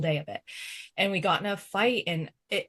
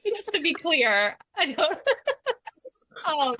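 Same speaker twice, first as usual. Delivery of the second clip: embarrassed laughing; 9 semitones higher, 4 dB louder than usual. Real, not acted. Embarrassed laughing over bathroom detail.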